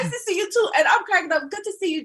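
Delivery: high, giggling reply; laughing greeting. Retold or imitated. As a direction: answer through laughter.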